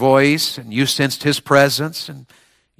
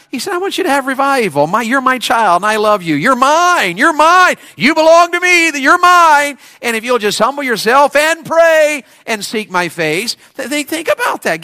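high-pitched preacher's cry, swelling louder; impassioned preaching; 12 semitones higher, 3 dB louder than usual. Acted. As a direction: proclaim God's claim on his child at full voice. Preaches God's words to congregation.

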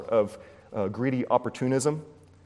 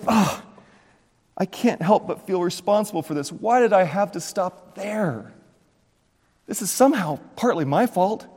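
anguished regret; 7 semitones higher than usual. Retold or imitated. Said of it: "He's weeping".

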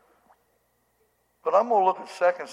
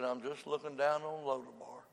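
quiet altar call; 8 dB quieter than usual. Acted. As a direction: draw the lost forward gently. Soft altar call over music.

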